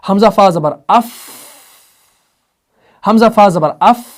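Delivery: "अफ" starting full, then a long fading f; trailing hams airflow. Shown as a demonstration. Teacher explaining the sound as waves, "अफ" fading into breath. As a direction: open firmly, then let "अफ" dissolve into air. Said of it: "मैं आपको waves में समझाऊं".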